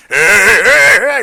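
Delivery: loud catchphrase call, mildly voiced; Fat Albert. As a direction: call out the cartoon's hey hey hey. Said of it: "I loved Fat Albert".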